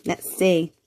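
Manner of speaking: ordinary delivery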